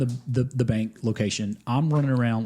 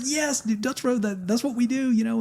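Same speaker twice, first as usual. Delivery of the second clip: excited quote; 13 semitones higher than usual. Retold or imitated. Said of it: "they were like".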